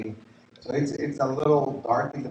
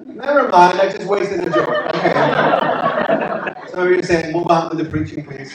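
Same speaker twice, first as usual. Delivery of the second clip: laughing at his own failed joke, louder; 5 semitones higher, 10 dB louder, slower than usual. Real, not acted. Pastor laughing at his wasted joke with the congregation. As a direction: chuckle through the words, giving up on the joke.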